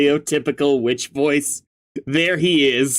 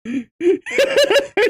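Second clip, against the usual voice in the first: witch cackle. Acted. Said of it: "She's back!".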